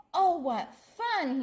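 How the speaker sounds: high, slow, smiling delight. Acted. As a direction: savour the fun with a grin. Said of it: "he said with a smile".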